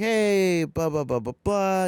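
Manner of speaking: high, loud hello tumbling into filler babble; hammed-up greeting. Joining an old friend's group chat, hammed-up cheery greeting.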